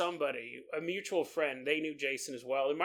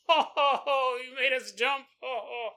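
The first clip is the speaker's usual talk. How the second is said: mock jolly laugh; 7 semitones higher, 4 dB louder than usual. Expected jolly reaction of friends.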